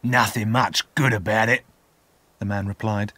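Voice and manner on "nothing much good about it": grumbling man's character voice; rough man's sullen reply; gruff convict. A narrator's reading. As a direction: grumble it back sourly as the convict.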